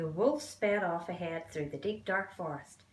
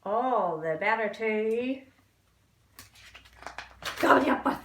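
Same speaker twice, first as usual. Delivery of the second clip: big bad wolf roar; 2 semitones higher, 7 dB louder than usual. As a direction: draw it out, then pounce on the last words. Wolf's climactic threat.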